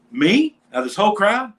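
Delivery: loud, high-pitched disbelief; can't believe your luck; excited. Real, not acted. Marveling at his luck.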